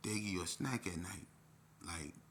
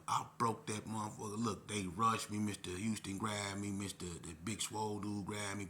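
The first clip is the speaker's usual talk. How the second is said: usual speaking voice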